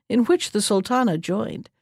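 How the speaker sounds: plain reading voice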